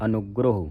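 ਅਨੁਗ੍ਰਹ as "a-nug-gro-hu", falling; tight pronunciation. Shown as a demonstration. Teacher repeats the correct reading, tight ਅਨੁਗ੍ਰਹ ending in "hu". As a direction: lean on the middle of ਅਨੁਗ੍ਰਹ and finish on "hu". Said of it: "ਫਿਰ ਕੱਸ ਕੇ ਉਚਾਰਨ ਹੋਵੇਗਾ".

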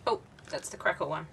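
ordinary talk

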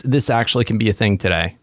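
normal talking voice

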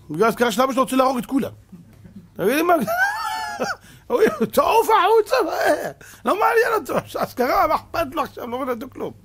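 loud, high-pitched furious yelling; mock outrage from an angry man demanding the Torah. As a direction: shout him out like an offended worshipper. Angry congregant demanding an aliyah.

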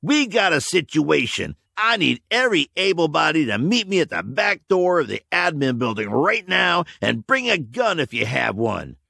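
rally the men fast; urgent commander. A narrator's reading. Leader calling his men to arms, urgent.

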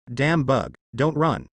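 plain reading voice

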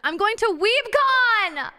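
loud, high, drawn-out cheer; gleeful high-pitched yell; excited announcement. Real, not acted. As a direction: shout the big news, stretching it out. Calls out a missed announcement.

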